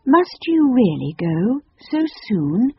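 high, slow, courteous question; polite. A narrator's reading. Girl answering the departing Phoenix's farewell, politely regretful. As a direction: feign polite regret, drawing it out. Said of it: "murmured Anthea politely".

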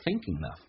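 Thinking of with the G dropped, N running into of; thinking of连读; linked thinking of. Shown as a demonstration. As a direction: link thinking of as one word. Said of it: "用N跟后面的a形成一个连".

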